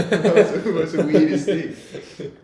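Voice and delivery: high, loud, laughing through the words; slow down on the punchline, cracking up; laughing disbelief. Retold or imitated. Friends reacting to a mate's random scream, laughing.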